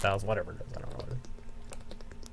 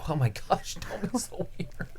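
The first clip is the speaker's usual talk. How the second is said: normal talking voice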